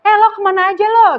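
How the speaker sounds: loud, high Jakarta slang shout; playful Jakarta street shout; Jakarta loud call. Retold or imitated. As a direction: mimic a brash Jakartan calling out. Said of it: "Jakarta kan biasanya".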